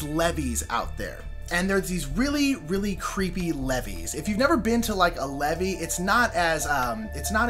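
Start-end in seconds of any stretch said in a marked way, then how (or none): none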